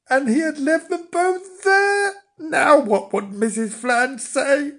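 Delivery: high-pitched worried wail; high, fretful dismay. A narrator's reading. Flange dreads his wife's reaction.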